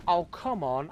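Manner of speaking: ordinary delivery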